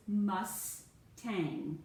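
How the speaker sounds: "Mustang" slowly, a gap between "Mus" and "tang"; split into syllables. Shown as a demonstration. Teacher showing student the first syllable, word split into syllables. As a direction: break "Mustang" into two drawn-out syllables. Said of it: "So the first syllable is".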